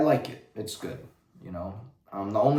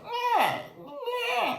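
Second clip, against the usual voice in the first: put-on squeaky baby-creature babble, very high; Grogu babbling. Vlogger mocks Grogu's babbling while piloting, squeaky. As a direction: mimic Grogu's whiny babble. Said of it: "sitting there like".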